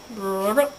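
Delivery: low, short ribbit; frog croak. Pretend. Playing animal noises as a frog, croaking low. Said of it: "I'm a frog".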